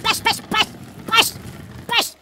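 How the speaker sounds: urgent urging. Real, not acted. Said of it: "urging the object on".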